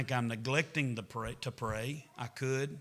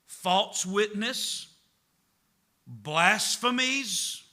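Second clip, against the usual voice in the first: slow, loud, high preacher cadence, each sin hammered out; preaching scripture; 5 semitones higher, 8 dB louder, slower than usual. Acted. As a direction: proclaim the list like a revival preacher. Reads scripture at preaching force.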